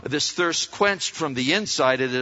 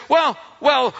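higher-pitched unsure questioner; hesitant seeker; 6 semitones higher, 4 dB louder than usual. Retold or imitated. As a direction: play the doubtful listener.

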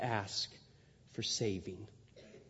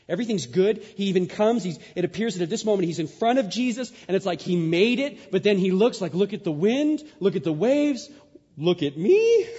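loud, high sermon climax; preaching at full force; 7 semitones higher, 10 dB louder than usual. Acted. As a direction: drive the story home to the congregation. Preaching Peter's moment, building.

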